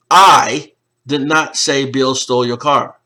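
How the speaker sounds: "I" mocno zaakcentowane na początku; stress on I. Shown as a demonstration. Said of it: "because of the inflection that I use".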